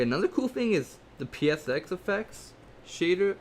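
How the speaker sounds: usual speaking voice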